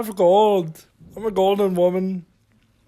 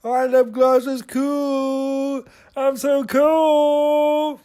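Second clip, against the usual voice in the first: slow, stretched-out boasting; drawn-out self-admiration; 3 semitones higher, much slower than usual. Real, not acted. Vlogger showing off lip gloss, drawn-out bragging. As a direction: draw every phrase out long.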